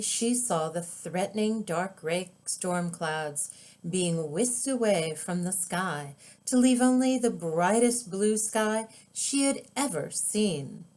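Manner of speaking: ordinary delivery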